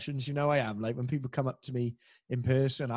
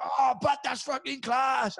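much higher, louder slow shout of delight; delighted fan shout; 13 semitones higher, 4 dB louder, slower than usual. Retold or imitated. Welsh fans cheering the impression, slow delighted shout. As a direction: bellow it like a thrilled Welsh fan. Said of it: "They're like that".

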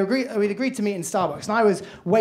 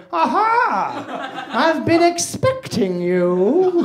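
James Bond villain; 4 semitones higher, much slower than usual. Pretend. To the startled woman, slow mock villain welcome. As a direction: purr it like a Bond baddie greeting his guest. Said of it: "playing a James Bond villain".

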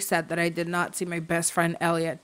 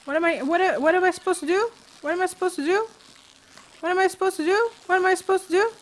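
very high-pitched baffled questioning; baffled pleading; 11 semitones higher, faster than usual. Real, not acted. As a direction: squeak the repeated question, helplessly confused. Baffled by fishing minigame, repeating.

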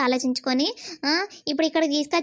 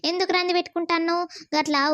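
normal talking voice

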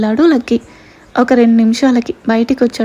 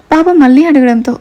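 ordinary delivery

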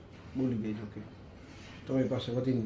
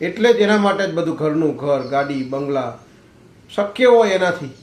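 loud, ringing sermon voice, climbing to peaks; fervent preaching; 7 dB louder than usual. Acted. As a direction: preach it hard to the crowd, swelling louder on each point. Preaches moral to audience.

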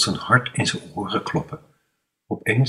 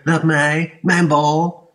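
Gerard hoog en luid na-apen; spottend nadoen; 8 semitones higher, 5 dB louder, much slower than usual. Retold or imitated. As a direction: bespot hem met zijn eigen kreet. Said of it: "Wie riep er". (Jan Remco aapt Gerard spottend na, hoog en luid)

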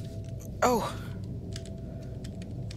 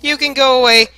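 high, loud furious yell; terrified yelling; 7 semitones higher, 8 dB louder, slower than usual. Real, not acted. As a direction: scream at it to leave. Screaming at chasing monster.

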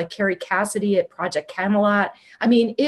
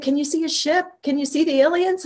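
high, eager questioning; excited friend; 8 semitones higher than usual. Retold or imitated. Friend asking by phone about aliens, excited. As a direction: imitate a thrilled friend's questions, pitch held high. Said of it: "she's all excited".

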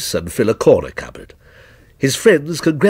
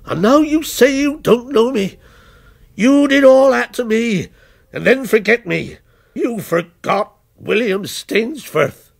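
bitter accusation; 8 semitones higher than usual. A narrator's reading. Villain accuses his creator.

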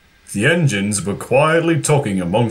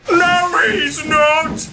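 interrupting; slower than usual. A narrator's reading. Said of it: "interrupted Percy".

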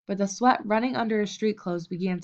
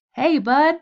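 loud, higher call across the gym; shout; 6 semitones higher, 8 dB louder than usual. A narrator's reading. Red shouting at Bud across the gym, loud call. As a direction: shout his name to get attention. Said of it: "shouted Red".

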